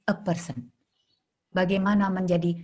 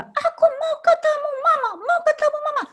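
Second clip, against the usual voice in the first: put-on much higher, tearful child's plea for mama; crying child; 3 dB louder than usual. Therapist imitating the girl crying for her mother, much higher.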